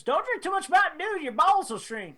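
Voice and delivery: put-on high, sing-song retelling of a kids' scare saying; mock warning. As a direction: playfully parrot the old schoolyard warning. Quoting a childhood warning.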